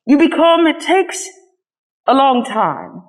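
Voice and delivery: a hint of a gentle old horse explaining; lightly voiced skin horse. A narrator's reading. Wise old skin horse reassures the rabbit, lightly voiced. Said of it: "said the skin horse".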